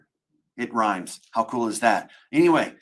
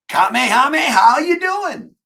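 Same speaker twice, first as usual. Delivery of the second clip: anime attack shout; 10 dB louder than usual. Acted. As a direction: bellow it like a martial-arts special move.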